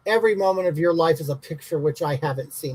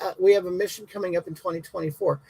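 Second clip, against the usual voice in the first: normal talking voice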